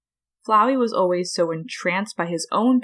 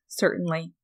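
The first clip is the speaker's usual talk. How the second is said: ordinary delivery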